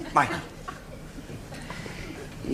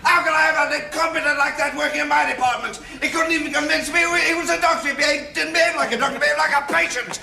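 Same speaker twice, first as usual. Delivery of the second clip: much higher, louder, fast furious tirade; furious rant; 14 semitones higher, 4 dB louder, much faster than usual. Acted. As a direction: rattle off the outrage at full volume. Furious doctor rants at assistant.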